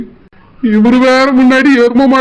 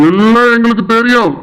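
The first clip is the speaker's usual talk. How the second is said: a lightly voiced stern elder brother-in-law; a hint of a stern older brother; 3 semitones lower than usual. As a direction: scold him as the wife's protective brother. Brother-in-law cuts the husband off.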